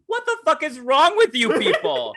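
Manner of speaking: very high, loud mock-outraged yell; retold shouting. Remembering a friend grabbing the arm, shouting at the group. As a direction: shout it incredulously, on the edge of laughter. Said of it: "me shouting".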